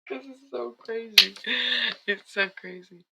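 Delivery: high, giggly disbelief; real laughter bubbling into the words; laughing. Real, not acted. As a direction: speak through the tail of a giggling fit. Giggling after her accent demo.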